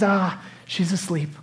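unhurried, understated reply; acted serene answer amid the uproar; calm reassurance. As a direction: drop to a slow, easy calm. Jesus calmly answering loud mourners.

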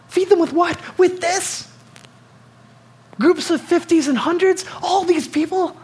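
high-pitched, disbelieving disciple; incredulous disciple. Retold or imitated. Hungry disciple questioning Jesus about feeding the crowd, high and incredulous. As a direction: play a flustered follower who can't believe it. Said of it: "you gotta be wondering".

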